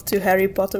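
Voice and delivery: normal talking voice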